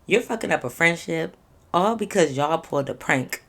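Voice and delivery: normal talking voice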